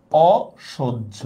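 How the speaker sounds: অসহ্য stretched very slowly, first vowel an open aw; open initial অ. Shown as a demonstration. Teacher showing why the negative prefix stays open, অসহ্য slowly with open aw. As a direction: draw out অসহ্য as "aw-shoj-jho", syllable by syllable. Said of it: "অটা বিবৃত হবে".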